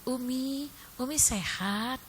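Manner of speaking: soft, slow, drawn-out greeting; hushed and leisurely mock-dainty voice. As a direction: imitate a delicate, gentle-spoken celebrity. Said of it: "itu [name] tuh ngomongnya halus loh".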